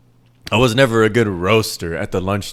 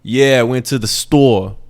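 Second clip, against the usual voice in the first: mock New York accent on "store"; louder parody of a New Yorker; 3 semitones higher, 5 dB louder than usual. Shown as a demonstration. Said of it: "Not like New York".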